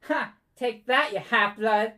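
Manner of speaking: put-on high, loud jeering child; taunting bully kid. Bully kid jeering at the half-elf boy, high and loud. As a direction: jeer at him like a nasty schoolyard kid.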